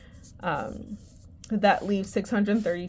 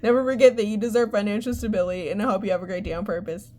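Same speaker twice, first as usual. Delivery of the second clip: fast sign-off; much faster than usual. Real, not acted. Rushed closing sign-off.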